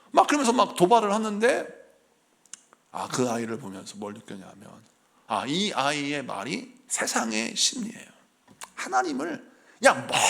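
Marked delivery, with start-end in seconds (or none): none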